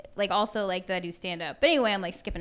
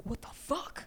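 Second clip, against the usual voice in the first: stunned whisper; 6 semitones higher, 8 dB quieter, slower than usual. Retold or imitated. Retelling shock at the callback.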